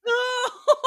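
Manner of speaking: high pained laugh, almost a cry; a sudden high laugh-cry of dismay; shocked laugh-cry. Real, not acted. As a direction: laugh out in horrified sympathy. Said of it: "how sad".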